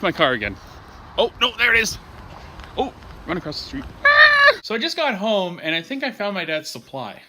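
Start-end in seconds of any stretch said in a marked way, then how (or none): 4.0-4.6 short, high, loud shriek; scream. Real, not acted. Vlogger dashing across the street, high scream. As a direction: let out a sudden high yelp.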